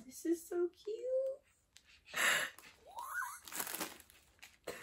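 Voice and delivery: high, soft squeak of delight; overwhelmed squeal. Real, not acted. Overcome by a cute gift.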